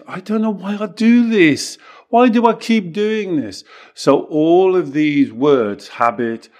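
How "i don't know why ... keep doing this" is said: high, plaintive mock of a stuck client; pitched-up imitation of a helpless client; whiny mock client. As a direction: whine it like someone who feels helpless. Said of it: "Do you say this?".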